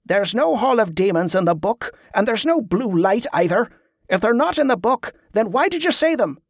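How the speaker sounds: high, exasperated protest; frustrated bewilderment. A narrator's reading. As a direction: demand an explanation, pitch climbing. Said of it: "a frustrated bewilderment".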